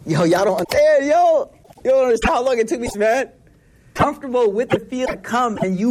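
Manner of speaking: grab the crowd with urgency; fired-up preacher. Real, not acted. Preacher urging the audience to get comfortable, fired up.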